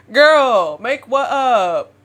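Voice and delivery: loud, slow, drawn-out scolding; emphatic disbelief. Acted. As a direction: stretch it out in loud mock exasperation. Repeats her mocking reply to herself.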